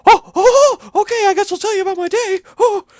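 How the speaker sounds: very high, fast squeaky cartoon voice; Mickey Mouse-esque voice. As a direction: chirp it out like a giddy cartoon mouse. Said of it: "that weird Mickey Mouse-esque voice".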